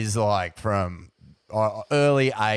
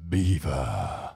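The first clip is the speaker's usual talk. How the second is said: catchphrase call; 7 semitones lower than usual. Retold or imitated. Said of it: "coined my favourite catchphrase of all time".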